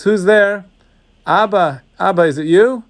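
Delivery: ordinary delivery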